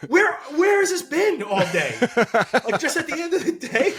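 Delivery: high, loud, laughing mock complaint; laughing tease. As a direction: tease her through laughter. Father teases daughter, laughing.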